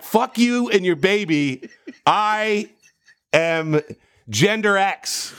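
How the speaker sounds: mock-defiant, high and drawn out; imitating a fan's proud comeback; defiant retort. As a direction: throw it back at him with attitude. Fan defiantly rejecting him.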